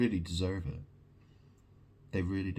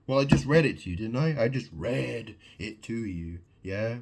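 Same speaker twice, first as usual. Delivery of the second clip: normal talking voice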